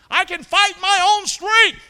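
high, loud, slow bragging; boast. Acted. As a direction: puff up with false confidence. Peter's boast acted.

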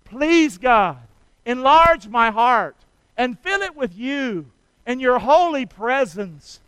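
loud, slow, fervent prayer; praying aloud for the church to repeat; prayer lead. Shown as a demonstration. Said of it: "Say this prayer with me".

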